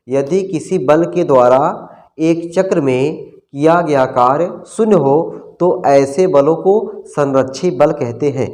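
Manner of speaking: slow, word by word for note-taking; teacher dictating a definition; slow dictation. Shown as a demonstration. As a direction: dictate it slowly so they can write. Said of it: "बोल दे रहा हूँ".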